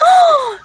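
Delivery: loud, high-pitched gasp; shocked gasp. Real, not acted. Listener hears where the thrown bottle landed, shocked high gasp.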